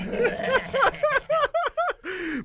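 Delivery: big high burst of laughter; loud high laugh. Real, not acted. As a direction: let out a loud shrieking laugh. Loud laugh after accent joke.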